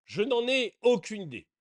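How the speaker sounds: ordinary talk, nothing marked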